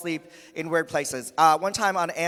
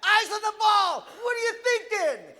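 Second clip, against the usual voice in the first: high-pitched mock sideline shouting; imitated overbearing dad yell; yelling sports dads; 13 semitones higher, slower than usual. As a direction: holler orders at your kid on the field. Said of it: "They're yelling at their kids".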